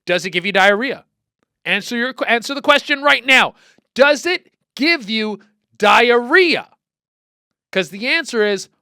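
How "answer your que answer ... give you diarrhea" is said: demanding shout. Real, not acted. Host yelling at Cincinnati listeners, loud demanding shout.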